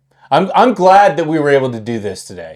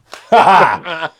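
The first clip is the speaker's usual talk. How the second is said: high burst of laughter; loud laugh; 6 semitones higher, 7 dB louder than usual. Real, not acted. Host laughs at the odd podcast ending, loudly. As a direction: crack up at the ending.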